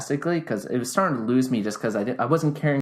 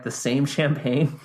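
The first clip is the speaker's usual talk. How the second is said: normal talking voice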